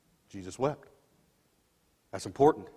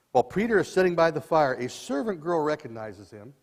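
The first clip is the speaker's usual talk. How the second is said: usual speaking voice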